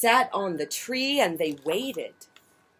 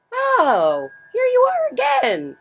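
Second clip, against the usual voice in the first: much higher, louder welcoming voice; friendly ladybug; 7 dB louder than usual. A narrator's reading. Friendly ladybug welcoming the grouchy one back, high. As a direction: greet an old friend brightly. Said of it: "said the friendly ladybug".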